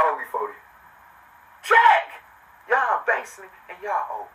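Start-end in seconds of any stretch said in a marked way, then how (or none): 1.6-2.2 high, loud single-word call; punched out high and loud; demanding shout. Real, not acted. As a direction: yell it to collect a debt. Calling in what others owe him.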